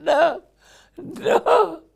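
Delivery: put-on very high squeaky baby no. Preacher imitating a toddler refusing, squeaky high.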